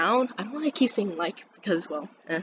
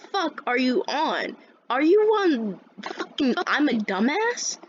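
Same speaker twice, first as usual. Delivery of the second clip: higher, drawn-out indignant challenge; 5 semitones higher, slower than usual. Retold or imitated. Student challenging teacher's reaction, indignant. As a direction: stretch it out in acted disbelief.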